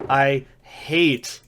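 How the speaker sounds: a beat, then "hate" hit hard and drawn out; stress on hate. Real, not acted. Player tells viewers what he can't stand, stress on "hate". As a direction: punch the word "hate" emphatically. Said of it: "I emphasize this word".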